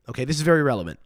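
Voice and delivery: normal talking voice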